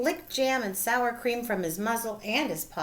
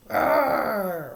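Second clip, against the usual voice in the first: louder rumbling roar; bear roar; 6 dB louder than usual. A narrator's reading. Bear roaring after losing the nuts, loud roar. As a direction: let out an angry bear roar. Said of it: "Old Bear roared".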